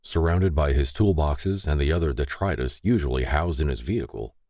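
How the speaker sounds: usual speaking voice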